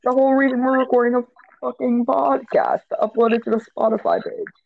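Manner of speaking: high-pitched scolding; yelling. Pretend. Podcast host telling off his friend, high-pitched yelling. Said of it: "Don't fucking yell at me".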